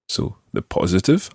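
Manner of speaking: usual speaking voice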